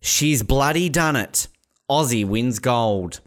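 slow, proud headline read; very Australian headline. Acted. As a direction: proclaim it in broad Aussie style. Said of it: "the most Australian headline".

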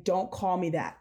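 ordinary talk

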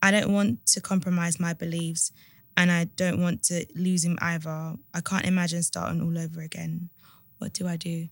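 measured, subdued read-aloud voice; letter reading. A narrator's reading. Host reading a listener's dilemma to co-host, subdued read-aloud.

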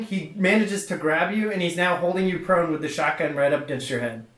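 normal talking voice

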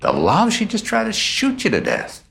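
exclaimed disbelief, pitch leaping high; real incredulity. Real, not acted. Host challenging victim's love for his shooter, incredulous. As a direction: challenge the answer in utter disbelief.

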